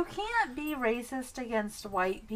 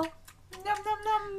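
nom nom sounds; 10 semitones higher, much slower than usual. Pretend. Pretend eating sounds.

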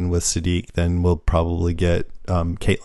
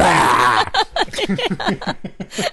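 zombie growl; 10 semitones higher than usual. Retold or imitated. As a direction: snarl like a zombie. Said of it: "here's my zombie sound".

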